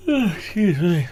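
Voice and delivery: said mid-yawn, high, loud and slow; tired yawn breaking the apology; yawning through words. Real, not acted. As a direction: let a real yawn stretch the words. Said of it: "Don't know why I'm yawning".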